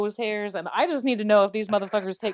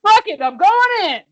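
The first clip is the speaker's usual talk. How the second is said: loud, high shout; fired-up shout; 12 semitones higher, 10 dB louder than usual. Retold or imitated. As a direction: yell it, charging in. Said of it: "And then she's like".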